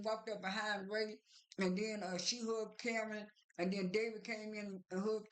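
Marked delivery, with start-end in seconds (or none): none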